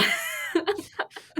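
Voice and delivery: a real burst of high laughter; high squealing laugh. Real, not acted. Guest cracking up at her own junk drawer punchline, high squeal.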